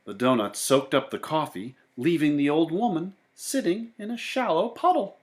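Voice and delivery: ordinary delivery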